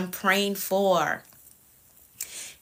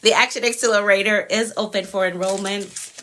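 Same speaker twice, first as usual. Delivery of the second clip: ordinary talk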